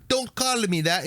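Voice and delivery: high mock British kid; badly faked British accent; attempted British accent. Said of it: "he was talking to the Brit".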